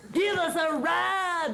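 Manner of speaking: slow, high, shouted plea for a ride; twins begging. A narrator's reading. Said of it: "the twins said".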